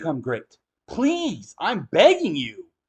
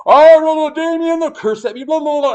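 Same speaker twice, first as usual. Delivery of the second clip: high, loud mock whine of a defensive bigot; whiny defensive bigot; 10 semitones higher, 8 dB louder than usual. Mocking a bigot who defends himself, high whine. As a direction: squeal out the excuses, mocking them. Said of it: "They'll defend themselves".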